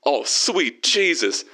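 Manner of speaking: slow, swooping cry at ordinary volume; overcome. A narrator's reading. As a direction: stretch each word in acted ecstasy. Vern overcome during phone call.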